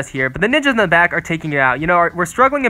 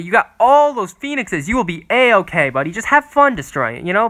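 ordinary talk

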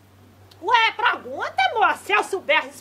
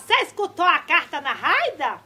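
ordinary talk

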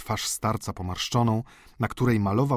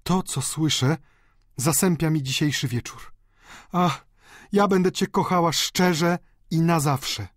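higher-pitched, earnest feminine voice; loving young woman; 7 semitones higher than usual. A narrator's reading. Delfina declaring her love to Eugeniusz, earnestly. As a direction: pledge love in a raised, feeling voice.

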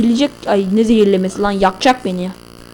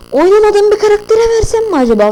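much higher, louder musing; excited; 10 semitones higher, 6 dB louder than usual. Real, not acted. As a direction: pop up high and loud. Sudden high loud line.

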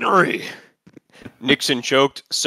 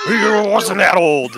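defensive; 5 semitones higher, 8 dB louder, much slower than usual. Acted. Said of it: "Nixon defended".

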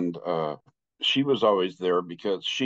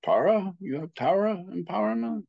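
higher, questioning lama voice; concerned lama; 11 semitones higher than usual. Retold or imitated. Lama checking the student's empowerments, concerned and higher. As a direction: ask with growing worry. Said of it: "He's becoming more and more concerned".

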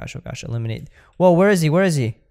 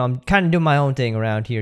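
ordinary talk

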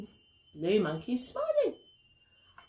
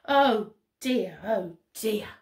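usual speaking voice

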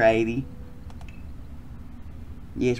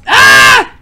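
high scream; 18 dB louder than usual. Acted. Character scream.

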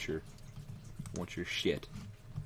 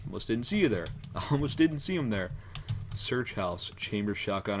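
normal talking voice

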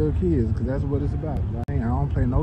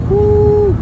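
excited whoop; 8 dB louder than usual. Real, not acted. Whoops before the race ride.

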